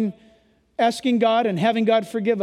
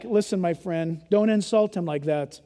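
soft appeal; 5 semitones lower, 4 dB quieter than usual. Real, not acted. Tender pastoral plea.

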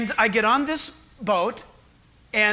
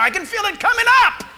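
higher and louder alarm; rising panic; 10 semitones higher, 5 dB louder, faster than usual. Retold or imitated. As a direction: sound like the nausea is winning. Seasick, about to vomit.

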